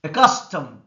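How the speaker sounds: Accustomed with a loud, punched CUS; stress on second syllable. Shown as a demonstration. Teacher working through exercise words, loud stress on CUS in Accustomed. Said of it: "C is stressed".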